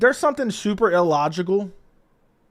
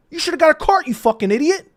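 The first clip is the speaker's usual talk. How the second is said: retold outburst, pitched way up; infuriated; 10 semitones higher, 3 dB louder than usual. Storyteller berates the man without a cart, infuriated. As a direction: act out exasperated insult, high-pitched. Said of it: "I got immediately infuriated".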